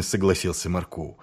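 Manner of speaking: plain reading voice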